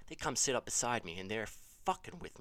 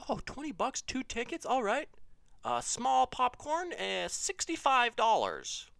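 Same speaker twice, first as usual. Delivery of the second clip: high-pitched cashier act, louder on the price; mock cashier; 12 semitones higher, 7 dB louder, slower than usual. Seller quoting movie snack prices to customer, mock cashier voice. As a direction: put on a high counter-clerk voice.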